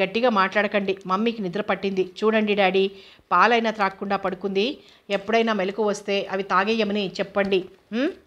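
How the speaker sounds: usual speaking voice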